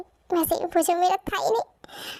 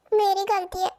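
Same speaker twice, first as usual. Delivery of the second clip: ordinary delivery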